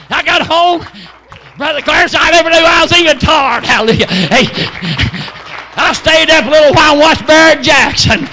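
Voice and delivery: loud shouted testimony, pitched high; full-force preaching. Real, not acted. Preacher testifying that the song revived him, full-force preaching.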